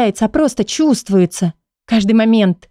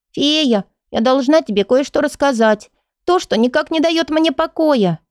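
put-on whispering little girl; 6 semitones higher than usual. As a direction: play a small girl confiding. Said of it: "прошептала девочка".

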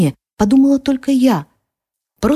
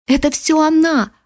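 put-on yelling boy; 6 semitones higher than usual. A narrator's reading. Boy yells his blame at the narrator. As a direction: cry out the accusation in a high voice. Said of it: "орал мальчишка, срывая голос".

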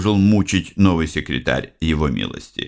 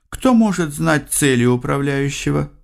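usual speaking voice

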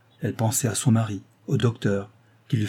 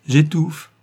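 higher, louder outcry; stifled-woman's cry; 6 semitones higher, 6 dB louder than usual. A narrator's reading. Woman answers her visitor about her boredom, crying out. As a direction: burst out as if crying out. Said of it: "répondit-elle comme un cri".

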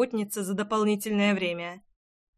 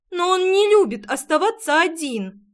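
put-on high, loud protesting little girl; acted childish insistence, pitched up; insistent child; 7 semitones higher, 6 dB louder than usual. A narrator's reading. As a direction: plead stubbornly in a child's voice. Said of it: "не сдавалась Ная".

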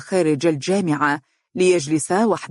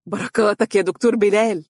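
higher-pitched, mildly elated; relieved joy, voice lifted; joyful; 4 semitones higher than usual. A narrator's reading. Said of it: "تنهد بقوة وهو يقول بفرحة". (بفرحة)

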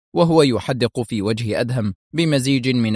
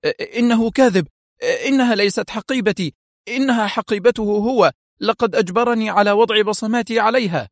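much higher, stammering frightened denial; accused thug protesting to the officer, high and scared; panicked shout; 11 semitones higher than usual. A narrator's reading. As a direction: deny it desperately, in a panic. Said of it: "صاح رشاد في ذعر".

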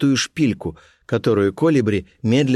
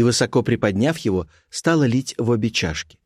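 plain reading voice

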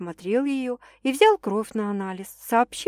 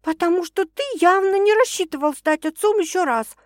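high-pitched, pointed explanation; raised woman's voice, accusing; annoyed woman; 9 semitones higher, 3 dB louder than usual. A narrator's reading. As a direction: throw the accusation at him, high. Woman explains her anger.

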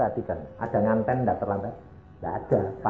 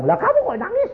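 very high mock weepy retort; imitating tearful friend. Friend answers the crying director, mock tearful squeal. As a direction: squeak the question back, comically tearful. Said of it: "Terus dia bilang".

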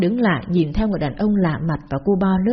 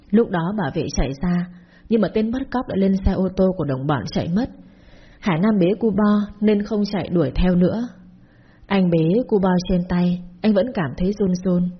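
usual speaking voice

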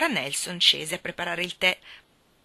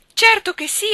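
even higher, louder quick "certo che sì"; unrestrained joy; 12 dB louder than usual. Acted. Nora accepting Jim's proposal again, ecstatic. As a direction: tumble it out in ecstatic repeat. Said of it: "esclamò Nora senza il minimo ritegno".

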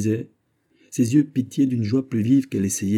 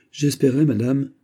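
pitched up, louder, rushing with nerves; an agitated courtier pleading; 7 semitones higher, 5 dB louder than usual. Acted. Fouquet pleading with the queen, very agitated. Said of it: "fort agité".

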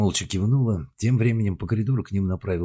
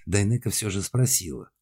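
ordinary delivery, nothing marked

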